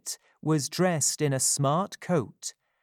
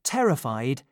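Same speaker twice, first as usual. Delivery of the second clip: usual speaking voice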